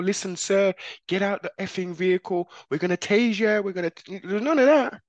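mock cop who talks like a thug; officer talking like the street; street cop imitation. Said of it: "these are popo".

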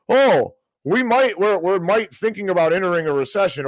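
mocking pundit. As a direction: ham up the timid pundit voice.

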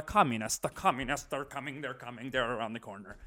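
low, hushed mock warning; softly ominous, mock propaganda; hushed scaremongering. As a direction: drop the voice like a creeping threat. Mimics scaremongers selling a communist threat.

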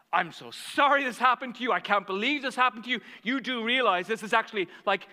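high, loud, rushing sympathy; fired up. Retold or imitated. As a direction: re-enact an impassioned, indignant apology. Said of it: "I'm getting all fired up".

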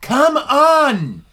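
exasperated elf yelling; indignant shout. Acted. Elf outraged at name mockery.

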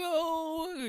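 high falsetto coo of delight; drawn-out squeal, imitated; so happy. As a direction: mimic a thrilled reaction. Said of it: "he was just so happy".

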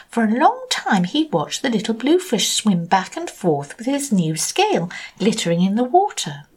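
usual speaking voice

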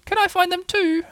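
very high, eager little-Roo voice. A narrator's reading. Roo asking to join the search, eager and high. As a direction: pipe up high, begging to join in. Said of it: "asked Roo eagerly".

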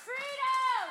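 high, drawn-out chant of freedom; high-pitched distant-sounding shout; imitated protest scream. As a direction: cry it out like a protester in a crowd. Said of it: "what I heard from the people screaming".

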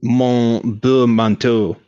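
slow, careful Duolingo-style French; slow French recitation. Pretend. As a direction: sound out a French sentence slowly like a learner.

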